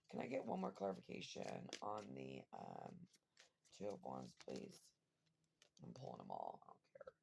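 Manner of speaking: low, soft murmur off to the side; hushed request. Real, not acted. Reader quietly asks the deck to clarify the Two of Wands, hushed. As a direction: mutter the question to the deck.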